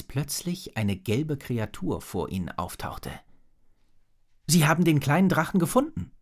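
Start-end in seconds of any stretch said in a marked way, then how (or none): 4.5-6.1 higher, louder and quick; quick, raised-voice exclamation; excited call. A narrator's reading. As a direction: call out the discovery in a rush. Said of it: "rief Drake".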